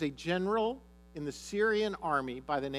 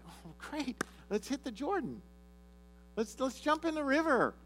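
mock cheerful, much higher sarcasm; sarcastic enthusiasm; 9 semitones higher than usual. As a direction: sell the joke with fake delight. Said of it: "I'd be like".

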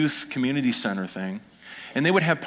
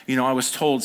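ordinary delivery

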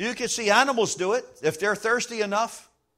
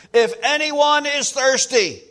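slow, higher, louder cry; loud proclamation; 4 semitones higher, 5 dB louder, slower than usual. Pretend. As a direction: call it out slowly to everyone. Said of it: "said in a loud voice".

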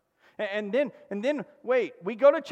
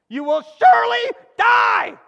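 high, loud, slow shouted warning; screaming command; 13 semitones higher, 10 dB louder, slower than usual. Retold or imitated. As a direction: thunder it out like God's command. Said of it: "It's like it's screaming at them".

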